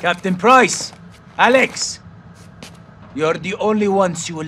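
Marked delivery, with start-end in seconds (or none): 0.0-2.0 high-pitched call for help; urgent calling out. Acted. Calling out to two allies.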